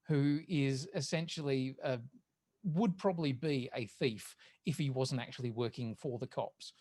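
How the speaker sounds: ordinary talk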